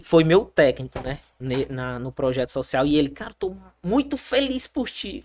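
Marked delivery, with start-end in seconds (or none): 3.1-5.2 high, soft acted congratulation; softer, lifted voice for quoted praise; retold proud coach. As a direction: lightly mimic a coach's heartfelt delight. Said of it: "Ele falando".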